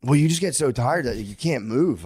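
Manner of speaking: normal talking voice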